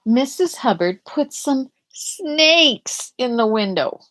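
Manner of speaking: ordinary delivery, nothing marked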